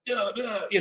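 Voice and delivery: high, softened mock yell; imitated argument, high and quiet; mock distant yelling. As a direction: yell in miniature, as if heard from afar. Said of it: "yelling at each other".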